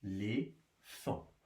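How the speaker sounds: "Éléphant" softly and slowly as é, lé, phant; split into syllables. Shown as a demonstration. Teacher counting syllables for pupils, "Éléphant" softly split in three. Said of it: "Trois syllabes".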